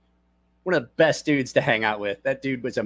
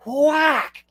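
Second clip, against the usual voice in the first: imitated whack; 13 semitones higher, 5 dB louder than usual. Sound of the hit.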